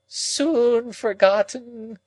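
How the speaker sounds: high, slow, drawn-out moan. A narrator's reading. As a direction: moan the words as a lament. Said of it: "moaned Miss Havisham".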